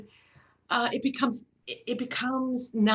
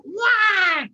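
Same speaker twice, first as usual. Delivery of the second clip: high, loud mock baby cry; imitating a baby's wail; 10 semitones higher, 8 dB louder than usual. Mother imitating her screaming baby, loud wail. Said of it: "every time it was like".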